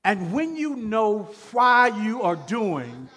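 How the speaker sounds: loud, high preacher's cry; preaching at full force. Acted. Pastor preaching to the church, loud and rising. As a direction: drive the point home to the congregation.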